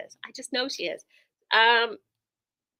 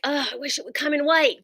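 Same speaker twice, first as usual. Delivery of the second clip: wishful quote; 6 semitones higher than usual. Acted. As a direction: act out a customer's wishful complaint. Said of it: "sometimes you're like".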